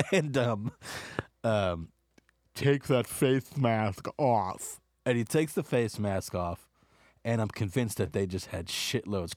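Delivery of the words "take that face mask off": slow, sinister whispering command; Snoke voice. Retold or imitated. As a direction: order it slowly like a menacing villain. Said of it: "How does Snoke talk?".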